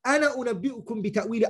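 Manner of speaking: fast, chanted verse recital; Quran recitation. Acted.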